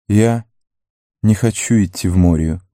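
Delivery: clear, deliberate statement. A narrator's reading. Legolas declines to enter Moria, clear voice. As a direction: pause after the first word, then state it clearly. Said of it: "ясным голосом произнёс Леголас".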